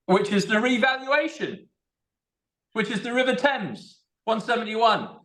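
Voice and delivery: high-pitched, excited exclamation; thrilled discovery. Real, not acted. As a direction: announce an astonishing match, thrilled. Thrilled by another number match.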